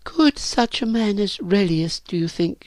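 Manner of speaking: put-on high, tentative girl's voice; hopeful question. A narrator's reading. Virginia asks whether Relius could want her, hopeful. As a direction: ask it high, shyly hoping.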